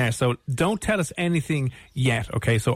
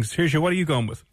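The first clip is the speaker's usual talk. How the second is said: ordinary talk, nothing marked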